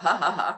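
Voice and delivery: deliberate "ha ha ha"; demonstrated laugh. Shown as a demonstration. Coach showing how to laugh it off, deliberate laugh. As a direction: laugh it off on purpose, evenly. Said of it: "laugh it off".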